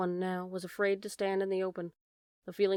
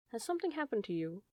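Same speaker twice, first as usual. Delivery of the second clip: softly voiced, worried question; quiet, concerned storybook character; 6 dB quieter, faster than usual. A narrator's reading. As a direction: ask it gently, hushed with concern. Owl rushes over to ask anxiously.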